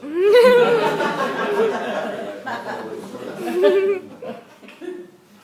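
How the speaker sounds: big high burst of laughter; loud, high cackling laugh. Real, not acted.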